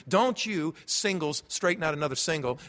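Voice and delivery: ordinary talk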